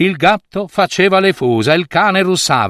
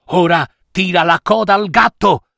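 usual speaking voice

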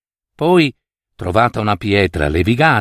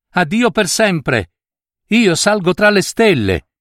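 higher, ringing farewell cry; girl calling down to her family, higher; called farewell; 7 semitones higher than usual. A narrator's reading. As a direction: call goodbye up into the sky. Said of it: "ed ella gridò".

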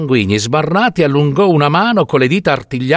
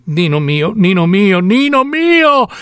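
anguished cry climbing much higher; 6 semitones higher, slower than usual. Acted. Wife crying out to her husband come back like a dead man. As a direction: call his name in rising, drawn-out despair. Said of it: "gli gridai".

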